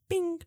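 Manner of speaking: a sharp, high "bing" like a card reader; high bing sound. Pretend. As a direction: chirp it like a contactless beep. Imitating a contactless card beep.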